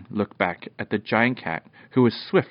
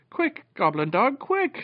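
very high urgent cry; urgent shout. A narrator's reading. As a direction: urge the dog on, voice shooting up. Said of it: "shouted Popoff".

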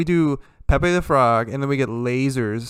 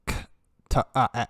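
usual speaking voice

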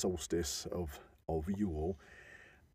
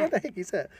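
put-on high, indignant kid voice; mock child sneering at the gift; spoilt child; 11 semitones higher, 4 dB louder, faster than usual. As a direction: play the disgusted little boy. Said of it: "he's gonna go around saying".

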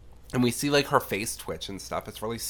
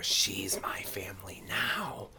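hushed, slow creepy whisper; eerie whisper; 2 semitones lower, 7 dB quieter, slower than usual. Retold or imitated. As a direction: breathe it like a ghost. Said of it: "And then we hear".

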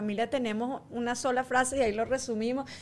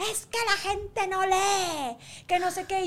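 high, drawn-out mock complaint; fussing man; 7 semitones higher, slower than usual. Man behind in line starts a fuss, indignant. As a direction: imitate an indignant man in the queue. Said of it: "un señor atrás me ha empezado a formar un lío".

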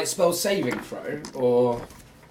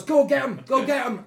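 urgent high yelled order; Gretsch Shotsinker; 11 semitones higher, 8 dB louder than usual. Acted. Gang boss sending the young boy out, urgent shouted order. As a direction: yell it loud and high to send someone off. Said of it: "you see he goes".

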